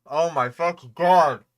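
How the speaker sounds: loud exasperated groan; exasperated outburst. Real, not acted. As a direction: blurt it out, fed up. Exasperated at repeated content.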